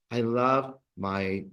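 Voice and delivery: slow model. Shown as a demonstration. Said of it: "we translated it as".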